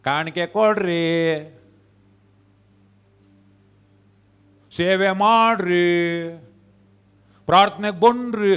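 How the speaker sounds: long-drawn, singsong appeal; mock coaxing. Pastor pushing members to give, serve and pray, mock coaxing. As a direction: drag out each request, imitating a nagging pastor. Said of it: "ಸ್ವಲ್ಪ ಜನ ಹೇಳ್ಬೇಕು".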